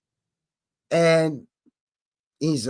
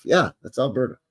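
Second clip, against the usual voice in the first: normal talking voice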